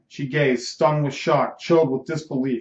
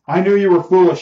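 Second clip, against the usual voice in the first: loud, higher furious outburst; raging; 5 semitones higher, 8 dB louder than usual. Acted. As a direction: let the rage burst out, louder and higher. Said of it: "she raged".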